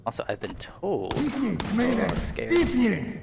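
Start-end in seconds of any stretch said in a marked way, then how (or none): 1.2-3.2 startled high cry; scared yelp. Real, not acted. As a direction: jump and squeal in fright. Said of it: "That really scared me".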